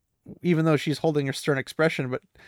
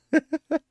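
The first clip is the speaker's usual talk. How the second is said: high giggle; 14 semitones higher than usual. Real, not acted. Reader laughing at line.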